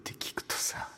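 soft unvoiced whisper. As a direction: breathe it like a secret. Whispered segment.

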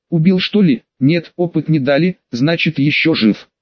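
plain reading voice

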